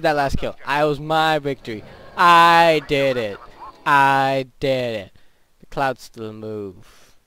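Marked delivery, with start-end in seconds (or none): 2.2-5.1 triumphant. Real, not acted. Celebrating victory.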